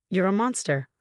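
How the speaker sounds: ordinary delivery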